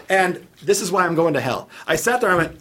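ordinary talk

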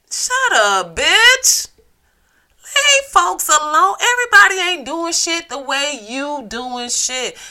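heated shout at the critics; angry rant. Real, not acted. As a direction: tell the critics off at full volume. Ranting at critics.

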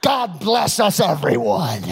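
loud mock holiday blessing; unsubtle dump-truck blessing. Preacher playing a tactless Christmas guest, loud mock blessing. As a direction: bellow the blessing without any subtlety. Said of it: "with the subtleness of a dump truck".

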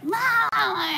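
high mock yell of Mom; calling out to mom in a high voice; retold angry call. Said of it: "I'm just like".

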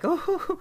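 high-pitched mock "oh-hoo-hoo"; imitated supportive hoot. Podcaster retelling a friend backing a joke, high imitated hoot. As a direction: hoot it high, mimicking a show character.

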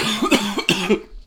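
sharp real cough. Real, not acted. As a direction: cough out the liquid. Coughing after drink.

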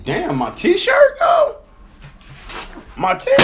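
high, loud, stretched-out yell; yelling in real shock at a soaked shirt; shocked shout. Real, not acted. Drink spilled on his shirt.

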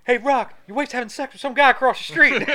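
high, loud mock holler; calling out. Pal calling to Rock about his wife, loud high holler. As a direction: shout the news over to a friend.

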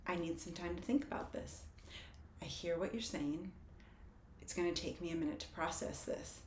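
soft, measured self-talk; calm. Shown as a demonstration. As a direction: say it gently, taking your time. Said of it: "what I can say is".

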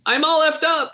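high-pitched mock wail; retold cry of distress; imitated tearful outburst. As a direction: blurt it out high, on the verge of tears. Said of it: "then she just starts crying".

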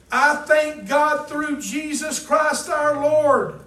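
loud, high, slow shout of thanks; preacher shouting Paul's triumph to the congregation; triumphant shout. Acted. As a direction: proclaim it like a victory. Triumphant scripture climax.

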